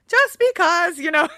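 high, loud, laughing cover-up; flustered answer. Retold or imitated. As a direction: fumble a reply through a laugh. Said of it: "And I was like".

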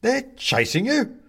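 slow, high, loud question; astonished echo. A narrator's reading. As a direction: stretch YOU upward in disbelief. Man echoes Benedict in question.

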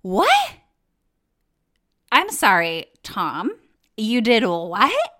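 incredulous you did what, pitch jumping up; shocked disbelief. Retold or imitated. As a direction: retell your stunned outrage at Tom. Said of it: "And I was like".

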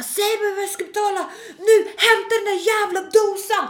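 high, loud furious demand; mock screaming. Customer demanding card machine, high mock screaming. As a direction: imitate a customer shrieking orders at the manager. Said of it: "hon står och skriker på henne".

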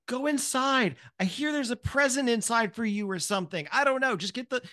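put-on high, fed-up mother shooing the kids away; a harried mom losing patience, pitched high; exasperated mom. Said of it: "and she's like".

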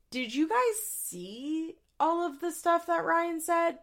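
lightly voiced earnest doctor, drawn out; imitating Drew. Imitating Doctor Drew's question.

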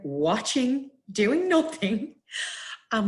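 slow, high-pitched emphasis; drawn out. Retold or imitated. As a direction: stretch it out high and incredulous. Stunned helplessness in retold story.

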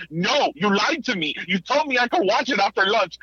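imitating a child's high-pitched accusation; accusing child. Son's accusation voiced by parent.

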